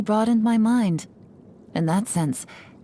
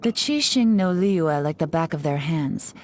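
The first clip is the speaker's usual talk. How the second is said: usual speaking voice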